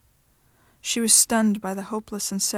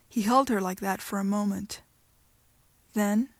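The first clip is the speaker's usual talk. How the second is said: ordinary delivery, nothing marked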